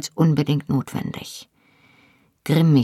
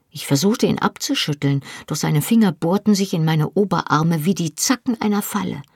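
plain reading voice

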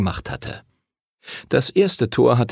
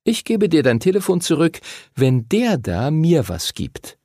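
leicht gespieltes Mädchen, höhere Stimme; hoch ansetzen und den Handel diktieren; fordernde Lucinda; 7 semitones higher than usual. A narrator's reading. (forderndes Mädchen, am Ende gedehnt)

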